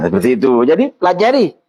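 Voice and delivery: normal talking voice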